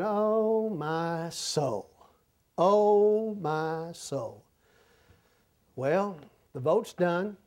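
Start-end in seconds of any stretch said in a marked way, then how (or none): 0.0-4.4 dismayed sigh. Retold or imitated. Acting out his dismayed reaction.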